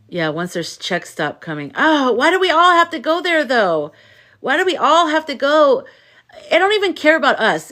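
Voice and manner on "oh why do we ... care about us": high, loud exasperated plea; exasperated protest. Real, not acted. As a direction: burst out in protest, pitch jumping up. Venting about everyone suffering together.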